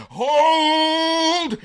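a huge, high battlefield shout of command; army captain bellowing hold; commander's shout. Retold or imitated. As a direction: hold the line with everything you've got. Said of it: "And he says".

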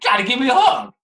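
loud high whiny demand; demanding shout. Retold or imitated. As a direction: yell it like a pushy kid. Imitating kid demanding a hug.

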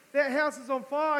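sermon point thrown out to the congregation; preached warning. Acted. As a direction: proclaim it like an alarm. Preaching point repeated loudly.

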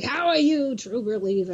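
lightly imitated comic-book showman greeting; Stan Lee. Comics idol greeting a young fan, showman-like. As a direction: start high and drop away, a hint of showman. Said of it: "And he's like".

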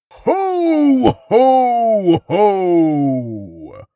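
booming, loud ho-ho-ho; Father Christmas laugh. Acted. Harry enters as Father Christmas, hearty shout. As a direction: bellow it out, starting high and falling.

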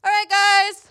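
high, put-on playful dad voice; dad's little voice. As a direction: imitate dad's little kiddie voice. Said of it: "he does that little voice".

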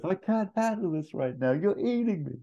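very high whining voice; distraught Ferrell. Retold or imitated. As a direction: plead for help near tears. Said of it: "He goes".

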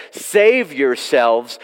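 each word drawn out slowly and pressed; plead, slow and urgent; slow earnest plea to the crowd. Retold or imitated. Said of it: "That's the text".

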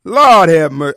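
usual speaking voice

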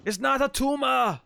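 put-on rough try at an Austrian accent; Arnold Schwarzenegger impression. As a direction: attempt an Austrian action-star accent, drawn out. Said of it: "are you doing the accent?".

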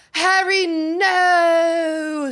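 grating, high-pitched mock girl voice; loud, drawn-out whine on a long NO; Hermione. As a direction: imitate an audiobook narrator's awful schoolgirl voice. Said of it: "so grating".

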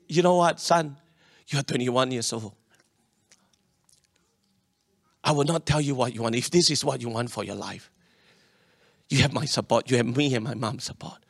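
low, soft fatherly reassurance; calm father voice. Retold or imitated. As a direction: speak as a gentle supportive dad.

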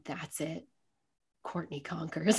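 quiet, reverent announcement; hushed reveal. Retold or imitated. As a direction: say it low like a revelation. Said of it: "he just turns to me and he goes".

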